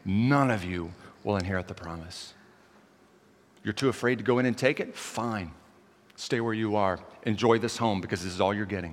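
acted stern judgment, held low; God. God passes judgment on the people, grave and low. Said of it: "that's judgment then".

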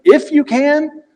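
disbelieving high question; Jesus answering the father, full of incredulity; incredulous. Retold or imitated.

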